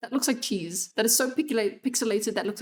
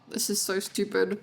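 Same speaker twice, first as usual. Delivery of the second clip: ordinary talk, nothing marked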